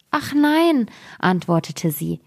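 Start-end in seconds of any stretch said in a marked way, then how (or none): 0.1-0.9 high-pitched little denial; princess protesting mildly. A narrator's reading. Princess answering her worried father, mildly protesting. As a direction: answer high, as the king's daughter.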